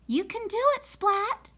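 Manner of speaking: encouraging teacher. Acted. Teacher encouraging shy cat.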